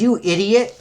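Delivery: high-pitched exclamation of "you idiot"; hammed-up comic-book outburst; acted insult. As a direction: throw the insult out, pitched well up.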